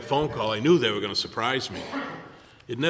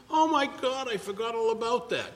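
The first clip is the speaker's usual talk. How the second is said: much higher, hammed-up realization; mock surprise; 10 semitones higher than usual. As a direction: gasp as the forgetful friend. Jason voiced, much higher.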